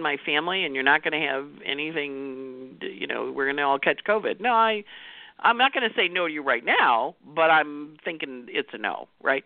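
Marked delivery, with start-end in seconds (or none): none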